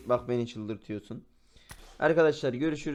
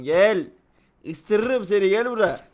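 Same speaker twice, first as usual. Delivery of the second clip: loud, raised playful threat; calling out a taunt; 5 semitones higher, 7 dB louder, slower than usual. Pretend. Gamer trolling an opponent to come over, loud taunting. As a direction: yell it over like a mock threat.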